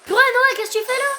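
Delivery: high, loud startled outburst; surprised shout. Real, not acted. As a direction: yell out in surprise. Interrupted by brother while filming.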